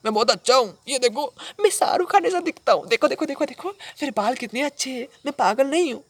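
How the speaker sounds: very high, loud frantic pleading; manic insistence. Acted. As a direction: insist wildly at the top of the pitch. Madman frantically insists he's sane. (पागलपन भरी ऊँची, उतावली ज़िद)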